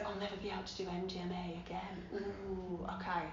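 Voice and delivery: whispered thoughts to herself; hushed inner monologue. Retold or imitated. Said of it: "And my head goes".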